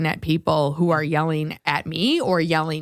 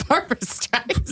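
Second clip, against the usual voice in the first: laughing; 4 semitones higher, 4 dB quieter, slower than usual. Real, not acted. Echoing the old voice.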